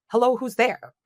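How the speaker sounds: high, loud phone greeting; answering the phone. Acted. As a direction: pick up the ringing phone and call out brightly. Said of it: "just pick up and ask".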